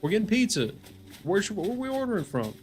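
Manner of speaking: a hint of an eager office organizer; mock-friendly office poll voice; coworker polling the office. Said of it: "But if somebody's like".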